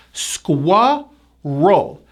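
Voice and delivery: Squirrel split into SKWUR, pause, RUL; two syllables. Shown as a demonstration. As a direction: separate Squirrel slowly into two parts. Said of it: "Two syllables".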